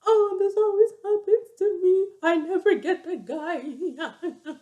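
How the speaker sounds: high, drawn-out mock wail; hammed-up sobbing, voice wobbling up high; imitated bawling. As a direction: imitate a girl crying her heart out. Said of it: "she starts bawling".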